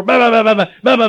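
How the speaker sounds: frantic mock pounding on a locked door; imitated door pounding. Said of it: "trying to get in the doggone door".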